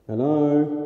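calling out. Real, not acted. Calling out into dark building.

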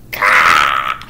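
low, loud angry gah; frustrated growl. Retold or imitated. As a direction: roar out your frustration. Sweeney screams in rage.

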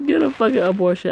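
laughing read. Real, not acted. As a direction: read it while cracking up. Laughing while reading the line.

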